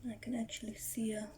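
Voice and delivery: hushed whisper near the spider; whispering while hiding. Real, not acted. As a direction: whisper so she isn't startled. Spider coming up, staying hushed.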